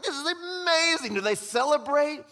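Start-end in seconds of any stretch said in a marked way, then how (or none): 0.0-1.1 squealing high delight; mock excitement. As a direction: cheer it way up high.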